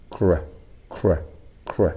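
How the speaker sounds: "cr" as a short isolated k-r sound; consonant blend. Shown as a demonstration. Teacher drilling the blend in criminal, short "cr" sounds. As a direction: repeat "cr" as separate short bursts. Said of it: "includes the consonant blend".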